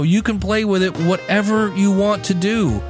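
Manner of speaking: normal talking voice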